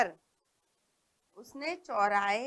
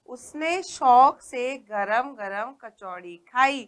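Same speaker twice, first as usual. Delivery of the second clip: slow, word by word, au sounds clear; slow careful reading; 4 dB louder, slower than usual. Shown as a demonstration. Teacher reading story sentence to class, slow and clear. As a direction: read it slowly for the children. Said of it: "तो हम पढ़ते हैं अब".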